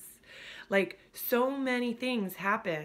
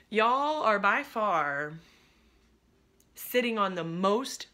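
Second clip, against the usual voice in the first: louder, slow, drawn-out emphasis; emphatic; 3 semitones higher, 6 dB louder, slower than usual. Real, not acted. Coach hyping up her potentializers group, loud and slow. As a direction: lean hard on by far.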